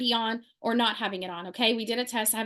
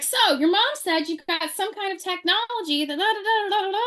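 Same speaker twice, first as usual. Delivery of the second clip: mock chatty lady; 7 semitones higher than usual. Mimicking the lady.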